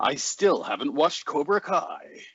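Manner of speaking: trailer voice. Pretend. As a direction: confess it as a drawn-out movie tagline. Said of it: "help you with the trailer voice".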